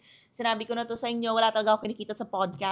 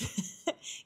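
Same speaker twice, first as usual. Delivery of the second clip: a quiet, airless chuckle; breathy laugh; 10 dB quieter than usual. Real, not acted. Laughing off a troll's comment with a quiet chuckle. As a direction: let out a soft, voiceless laugh.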